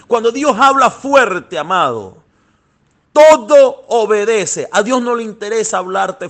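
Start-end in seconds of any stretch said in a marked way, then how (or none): 0.1-4.7 louder and higher, hammering the refrain; thundering pulpit call; rising preacher shout. Acted. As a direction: build it to a full-voiced climax. Preacher builds repeated refrain.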